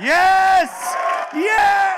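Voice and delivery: high excited yell; cheering shout. Real, not acted. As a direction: whoop for the guests. Cheering guests coming out.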